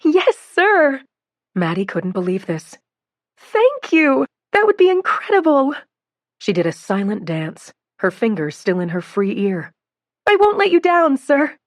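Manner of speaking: bursting with joy; thrilled. A narrator's reading. Thrilled by the job offer.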